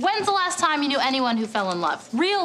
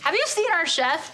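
usual speaking voice

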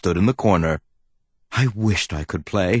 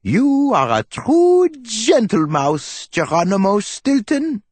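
professor voice; 14 semitones higher than usual. Acted. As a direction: praise him like a proud old professor. Professor praises Geronimo warmly.

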